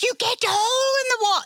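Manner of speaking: put-on very high, fast kids' shriek; excited mock-child squeal; amazed kids. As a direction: imitate thrilled children blurting out the news. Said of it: "And they're like".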